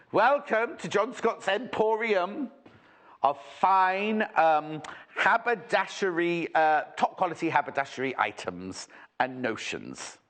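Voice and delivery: slow, grand showman welcome; mock-grand emporium welcome; shop welcome. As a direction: announce the shop like a ringmaster. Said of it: "shop announcement".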